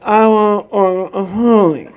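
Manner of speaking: loud, slurred nonsense order, drawn out at the end; hammed-up unintelligible customer; mock garbled speech. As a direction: answer in exaggerated mushy gibberish. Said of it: "played the same little game with me".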